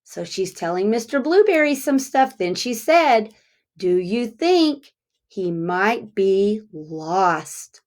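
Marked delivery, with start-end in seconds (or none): 3.8-7.8 slow, clear question to repeat; slow teacher demo; slow model phrase. Shown as a demonstration. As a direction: model the question slowly for children to echo. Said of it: "Let's say that together".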